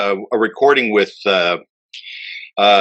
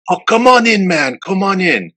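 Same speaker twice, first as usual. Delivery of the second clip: imitating Roland's greeting; 7 semitones higher than usual. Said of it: "he's just like".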